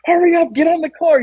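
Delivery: high-pitched mock panic; frantic, hammed-up warning; panicked retold urging. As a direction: urge a friend to safety, acted out.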